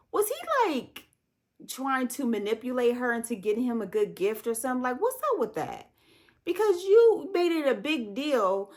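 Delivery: rising outrage on his behalf; spilling over with indignation; worked-up disbelief. Real, not acted. Indignant rant about the husband.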